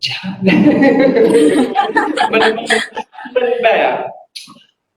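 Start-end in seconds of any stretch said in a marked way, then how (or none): 0.2-2.3 a sudden high laugh at the student's remark; high burst of laughter. Real, not acted. (หัวเราะเสียงสูงออกมาดังๆ)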